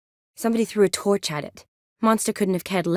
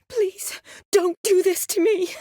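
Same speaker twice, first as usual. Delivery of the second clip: very high, distressed begging; desperate pleading; 12 semitones higher than usual. Acted. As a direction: beg in panic, voice pitched right up. Begging someone not to harm her.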